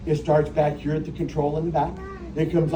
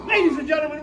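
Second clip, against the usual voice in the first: announcer call; 10 semitones higher, faster than usual. Acted. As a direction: ring it out like a ringmaster. Announcing end of the show.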